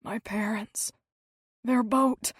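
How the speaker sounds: faint, strained whisper; dying husband straining to whisper; weak whisper. A narrator's reading. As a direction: barely get the words out. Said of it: "His words came slowly and required great effort".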